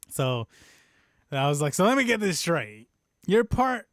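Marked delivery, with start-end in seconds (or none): 1.7-3.9 raised, louder mock disbelief; incredulous recap. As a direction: re-enact confronting a baffling friend. Retelling own incredulous recap to friend.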